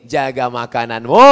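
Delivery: normal talking voice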